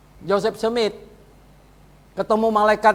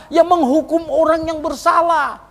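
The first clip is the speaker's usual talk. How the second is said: emphatic preaching; 10 semitones higher, 5 dB louder than usual. Real, not acted. Preacher drives home point.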